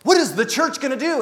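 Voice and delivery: mock indignant church lady; demanding cry. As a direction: push the question at him hard. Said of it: "this lady comes up and goes".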